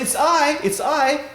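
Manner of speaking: high, loud, eager announcement; excited lover. Retold or imitated. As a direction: call out eagerly at the door. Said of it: "A lover goes excitedly".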